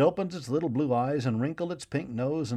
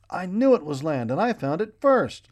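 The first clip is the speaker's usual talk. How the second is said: gleeful boast; 7 semitones higher, 7 dB louder than usual. A narrator's reading. Boy bouncing after finding land, loud gleeful boast. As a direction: crow with triumph. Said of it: "jumping up and down".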